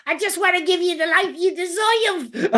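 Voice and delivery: high mock Penguin voice; playful Colin Farrell Oz impression. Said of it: "he's like".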